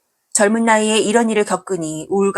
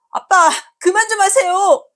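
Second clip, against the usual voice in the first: much higher, louder son's cry; son shouting; 13 semitones higher, 4 dB louder than usual. Acted. Son pulling father off his mother, pleading shout. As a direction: plead hard as the teenage son.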